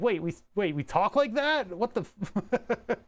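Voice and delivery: high-pitched stunned realization, imitating the kids; mock disbelief. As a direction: act out baffled kids hearing their own accent. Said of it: "And they're like".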